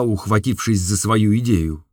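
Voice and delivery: ordinary delivery, nothing marked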